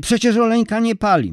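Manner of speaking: high, loud indignant protest; indignant grandmother. A narrator's reading.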